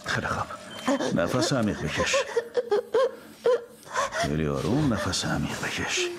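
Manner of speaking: put-on calm a panicking child gently; soothing a child. Father calms son's breathing.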